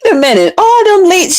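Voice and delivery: a hint of a startled mother; imitating mom. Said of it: "she was like".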